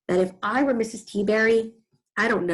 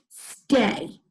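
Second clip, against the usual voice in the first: firm command to a dog. Acted. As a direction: order the pet to stay put. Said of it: "in a firm voice".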